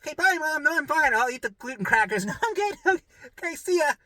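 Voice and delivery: high, overly bright mock fine voice; chirpy pretend-fine goodbye to mom; fake cheerful. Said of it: "I was like".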